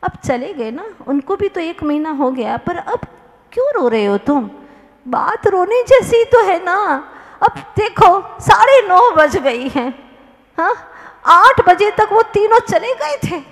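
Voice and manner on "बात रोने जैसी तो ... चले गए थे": heated complaint. Acted. Weeping man explains, heated.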